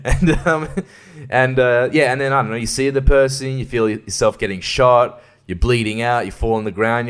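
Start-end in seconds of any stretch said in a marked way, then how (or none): none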